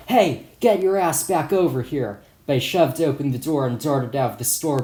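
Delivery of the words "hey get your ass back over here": louder, higher retold yell; shout. Shopkeeper yells at fleeing kid, shouting. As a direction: call after the runaway, mock shout. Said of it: "He shouted".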